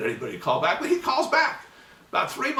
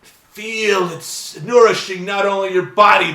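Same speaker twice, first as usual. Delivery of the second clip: building louder and higher, a hammed-up ad pitch; overblown ad copy; 5 semitones higher, 9 dB louder than usual. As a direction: sell it like heavenly juice, rising. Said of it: "the copy was".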